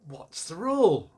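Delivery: mock-stern big sister testing a little one; sisterly quizzing. Retold sister testing the child.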